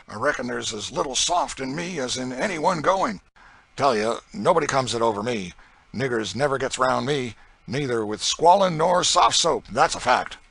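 loud, swaggering slave driver; coarse boasting planter. Acted. Planter bragging to a stranger about his system, loud and swaggering. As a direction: brag it out loud and hard. Legree boasting he's hard.

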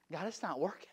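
low, very soft confessional delivery with long pauses; hushed preaching. Retold or imitated. Preacher confesses his hotel-room prayer, hushed and low. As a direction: drop to a quiet, halting testimony.

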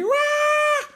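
sudden high yelp of pain; retold yelp. Man stung by insects, yelping high. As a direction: yelp like a bug just bit you. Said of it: "je commençais à crier".

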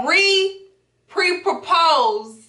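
slow, drawn-out re-pre-propose; said slowly. Real, not acted. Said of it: "I always have to say that slowly".

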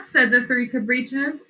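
plain reading voice

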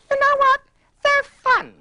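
very high, loud mock enthusiasm; loud, high-pitched gush; gushing approval. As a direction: squeal it as an imagined delighted verdict. Imagined quote of the other's verdict.

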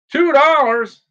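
high, loud startled "two dollars?"; shocked disbelief. Real, not acted. Streamer stunned by a viewer's cheap egg price, loud disbelief. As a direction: blurt it out in real astonishment.

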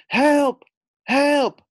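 high, loud shouts for help; cry for help. Acted. Frog calls friends for help, loud shouting. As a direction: yell help from the pond. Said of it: "Frog croaked".

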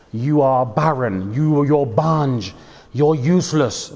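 slow, contemptuous taunting of the daughter-in-law; scornful mother-in-law. Retold or imitated. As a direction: drag out each insult like a spiteful in-law. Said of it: "made hell on earth for her daughter-in-law".

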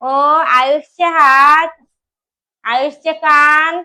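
slow sing-song with stretched final words. Real, not acted. Mother quizzing her toddler on body parts, sing-song prompting.

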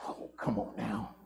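barely voiced plea; whispered aside. Real, not acted. Preacher coaxing a quiet church, whispered. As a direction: half-whisper it like a private nudge.

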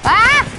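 sharp high loud cry; startled yelp. Real, not acted. Surprised in the game, startled high yelp. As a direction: caught off guard, yelp.

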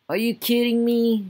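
high, loud disbelief; incredulous. Real, not acted. Player shocked by his Sim, loud disbelief. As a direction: cry out in disbelief at the screen.

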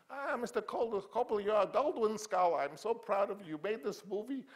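put-on high, loud, proud old mogul voice; Sam Goldwyn impression. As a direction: gush like the little studio boss. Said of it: "he talked like I'm gonna talk".

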